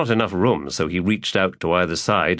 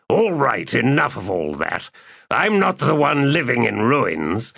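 high, proud, commanding voice; imperious warlord; 5 semitones higher than usual. Acted. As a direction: lord it over the hermit. Calor cuts in.

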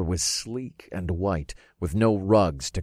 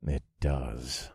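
low, quiet growl; lower, softer, growled agreement; 5 semitones lower, 6 dB quieter than usual. A narrator's reading. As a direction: growl it under your breath. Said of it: "I growled as I looked around".

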